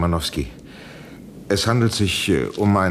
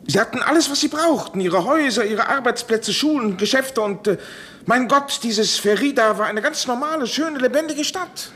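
pitch rising with emotion; 12 semitones higher than usual. Acted. Professor recalls the living town.

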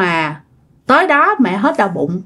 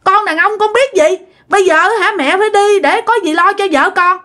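high, quick hammed-up pleading; mock urging; 8 semitones higher than usual. Husband urging his mother-in-law to come along, high and quick. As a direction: push grandma to come, playing helpless husband. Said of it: "ba nói".

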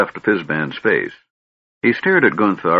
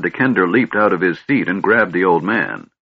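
usual speaking voice

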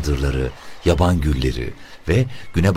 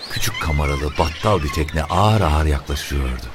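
ordinary delivery, nothing marked

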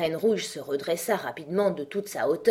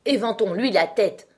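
mildly anxious, slightly raised voice; 5 semitones higher, 7 dB louder than usual. A narrator's reading. Red Queen wants Alice's head fanned, mildly anxious. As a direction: urge it with a touch of worry. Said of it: "d'une voix anxieuse".

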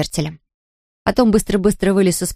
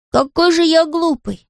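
higher, slow exclamation; boy's self-reproach; 8 semitones higher, slower than usual. A narrator's reading. Bertel sees his own foolishness, high slow exclamation. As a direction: realise it slowly in a raised boyish voice. Bertel scolds himself on sudden idea.

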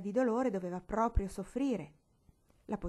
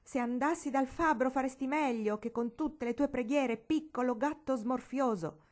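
scornful; 5 semitones higher, 4 dB louder than usual. A narrator's reading. Scornful child retort.

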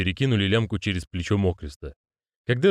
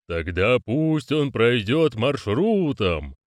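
very slow, solemn order; drawn-out imperious decree; commanding ritual voice; 4 semitones higher, much slower than usual. Acted. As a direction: command it slowly and ceremonially. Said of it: "скомандовал голос".

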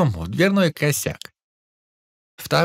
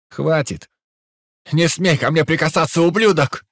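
boiling over; 2 semitones higher than usual. Acted. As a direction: snap in sudden rage. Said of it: "вскипел юнец".

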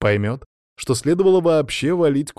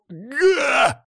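high, choked yelp cutting off speech; strangled cry. Acted. Lyokha's answer cut off as he shakes, crying out. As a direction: break off mid-word with a strained cry.

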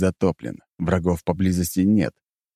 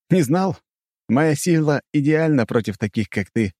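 smirking; 8 semitones higher than usual. Acted. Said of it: "ухмылялся я".

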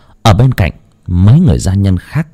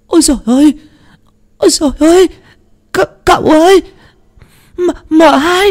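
very high, loud, stammering terrified wail; shrill stuttering cry of fright rising to a shout; panicked shriek; 3 dB louder than usual. Acted. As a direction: servant screaming the news, voice cracking in horror. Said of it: "Nó hét lên một tiếng thất thanh".

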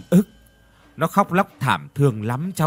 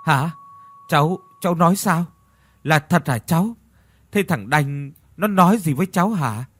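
put-on stunned old woman blurting questions, higher and louder; stammering in shock; 6 semitones higher, 4 dB louder than usual. A narrator's reading. Old mother hears her dead son spoke, stammering in shock. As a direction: gasp it out, stumbling over the words. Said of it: "Bà Liễu ú ớ".

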